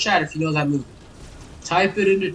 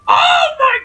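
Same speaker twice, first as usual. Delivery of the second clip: high, loud strangled cry of rage; fury breaking into a squeal; shrill-pitched outburst of real frustration; raging squeal; 8 dB louder than usual. Real, not acted. Gamer rage at failed jump.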